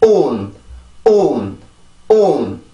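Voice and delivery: 온 falling from vowel into N; word ending alone. Shown as a demonstration. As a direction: drill only 온, separated by pauses. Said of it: "뒤에만 먼저 발음을 해봅니다".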